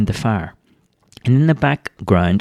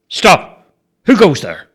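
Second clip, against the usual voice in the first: firm, loud Stop with force; authoritative challenge; 7 semitones higher, 4 dB louder, slower than usual. Shown as a demonstration. Bobo demonstrates the challenge with authority, firm and loud. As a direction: show him how a guard commands. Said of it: "put some authority in your voice".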